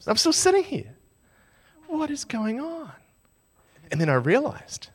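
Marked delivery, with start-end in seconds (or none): 0.0-2.9 quiet bewilderment. Retold or imitated. Bewildered after being passed over.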